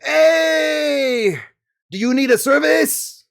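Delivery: high, loud, hammed-up alien mechanic; a loud, high Toydarian shopkeeper; mechanic NPC voice. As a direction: greet the customers in a squeaky shouted shop voice. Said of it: "This is bad, but we're doing it".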